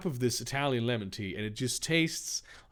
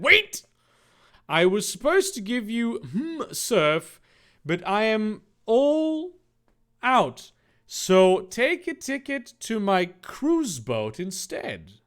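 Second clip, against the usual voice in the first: much higher, loud, slow fish-man voice; Purple Fishman voice; 9 semitones higher, 5 dB louder, slower than usual. Acted. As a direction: squeak it out like a goofy fish man.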